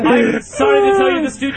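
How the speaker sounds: mock announcement. Announcing the result.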